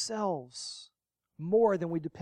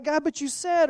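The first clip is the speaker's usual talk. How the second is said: complaining to God; 8 semitones higher, 4 dB louder than usual. Retold or imitated. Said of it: "And I was like".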